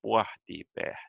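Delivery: "Pohti, behtet" strongest on "Poh", lighter on "beh"; stronger initial stress. Shown as a demonstration. Teacher shows main stress, "Pohti, behtet" strongest at the start. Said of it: "more stronger in the start".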